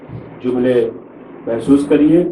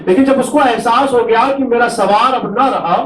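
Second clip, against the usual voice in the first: keen the tragedy aloud to draw the mourners to tears; masaib lament; 10 semitones higher than usual. Acted. Preacher to mourners at a majlis, high wailing lament.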